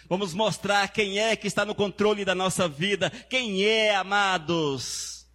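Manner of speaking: high ringing preacher's cry; preaching at full force. Acted. Pastor calls out to the church, preaching at full force. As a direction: lift the church with the promise.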